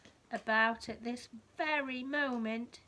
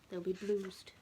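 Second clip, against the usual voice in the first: ordinary delivery, nothing marked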